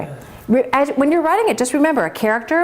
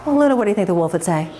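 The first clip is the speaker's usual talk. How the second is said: plain reading voice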